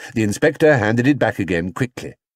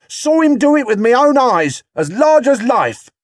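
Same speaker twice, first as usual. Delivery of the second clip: indignant witness; 7 dB louder than usual. Acted. Angry witness in a crowd.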